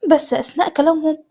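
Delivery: ordinary delivery, nothing marked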